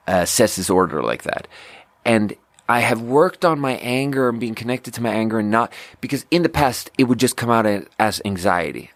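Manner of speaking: ordinary delivery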